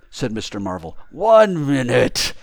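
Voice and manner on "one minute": loud, very slow, higher voice; tightly controlled, penetrating command; penetrating self-control. A narrator's reading. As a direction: hold back temper, stretching each word. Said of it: "said the voice penetratingly, tremulous with self-control".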